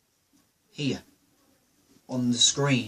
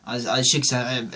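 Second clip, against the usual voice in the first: usual speaking voice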